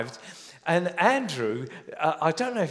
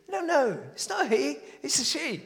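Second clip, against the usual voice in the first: much higher, patient correction; mother correcting; 11 semitones higher than usual. Retold or imitated. Mum correcting her son, higher and patient. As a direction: set the little one straight.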